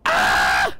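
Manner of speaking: loud short fright yell; startled yell. Real, not acted. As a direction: jump and yell in shock. Sudden fright in game.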